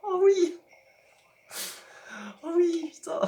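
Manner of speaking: high, delighted gasp of joy; thrilled. Real, not acted. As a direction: squeal with real delight at the prize. Delighted at new car.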